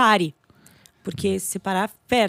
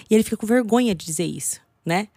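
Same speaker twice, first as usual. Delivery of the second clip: ordinary talk, nothing marked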